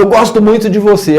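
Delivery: high, forceful threatening affection; menacing. Acted. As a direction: make the kind words sound like a threat. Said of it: "como numa forma ameaçadora".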